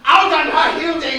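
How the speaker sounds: father cursing his daughter aloud; angry shout. Acted. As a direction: spit the insult out.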